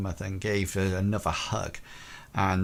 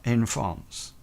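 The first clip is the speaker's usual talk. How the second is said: higher French governess voice, quickened; French accent; 4 semitones higher than usual. Acted. Governess tells Peabody she was born in Brittany, French accent. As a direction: answer crisply with a French lilt. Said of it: "And she says".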